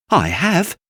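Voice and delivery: high, loud hurt protest; little rabbit crying out. Acted. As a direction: defend yourself fiercely. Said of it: "cried the little rabbit".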